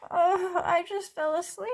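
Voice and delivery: high, complaining little seed; whiny sleepy seed. Acted. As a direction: protest that you just dozed off. Seed character protests sleepily.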